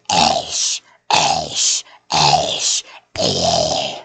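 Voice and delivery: very low raspy hurt zombie grunts; Minecraft zombie. Shown as a demonstration. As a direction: grunt like a zombie being hit. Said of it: "Minecraft zombie impression".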